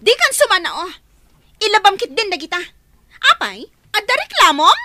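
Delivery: scolding. Acted. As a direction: put the maid in her place. Daughter scolds the maid.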